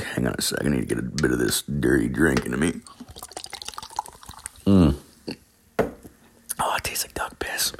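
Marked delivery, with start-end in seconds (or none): 4.6-7.7 whispered aside. Real, not acted. Grimacing aside after a drink.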